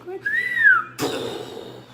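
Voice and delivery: sharp rising gasp of mock panic; panicked gasp. Picturing a plane crash, a sharp rising gasp.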